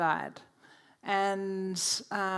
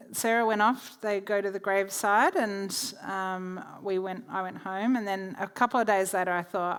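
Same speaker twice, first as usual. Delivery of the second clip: usual speaking voice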